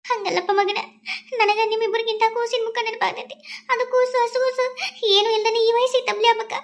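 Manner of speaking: put-on high, pleading old mother; old mother's voice. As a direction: plead with your son like an aging village mother. (ಮಗನನ್ನು ಬೇಡುವ ವಯಸ್ಸಾದ ತಾಯಿ, ಎತ್ತರದ ಧ್ವನಿ)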